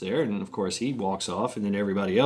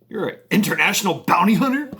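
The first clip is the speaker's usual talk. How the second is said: high, loud disbelieving question; mock incredulity; 9 semitones higher, 6 dB louder, faster than usual. FBI agent questioning Steve's bounty hunter claim, incredulous. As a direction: act out scornful disbelief.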